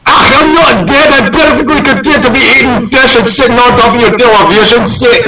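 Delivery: penguin in a hammed-up Scottish accent; a lightly Scottish talking penguin. Penguin answers his owner in a Scottish accent. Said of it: "What, is it Scottish?".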